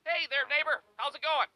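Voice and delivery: cheery neighbor greeting. Retold or imitated. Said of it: "me being like".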